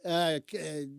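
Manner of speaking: ordinary delivery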